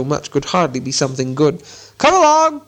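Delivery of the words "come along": high, loud call; shouting. A narrator's reading. Bilbo hurries Gollum along, shouting. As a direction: shout it out to hurry someone. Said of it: "he shouted".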